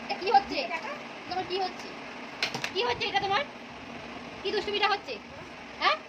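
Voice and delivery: playful scolding. Pretend.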